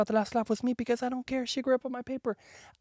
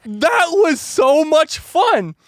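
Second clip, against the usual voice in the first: slow, loud, high gushing; gushing excitement; 7 semitones higher, 8 dB louder, much slower than usual. Retold or imitated. Telling the stern teacher, loud and gushing. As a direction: gush it out, oblivious. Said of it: "So I said".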